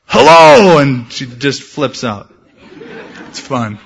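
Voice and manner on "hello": high, loud sudden hello; playful booming scare greeting; sneak-up shout. Acted. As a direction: shout it to make someone jump. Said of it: "sneak up behind her and go".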